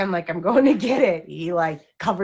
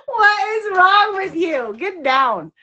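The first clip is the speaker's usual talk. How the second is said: mock exasperated; 10 semitones higher, 7 dB louder, slower than usual. Real, not acted. Scolding dog playfully.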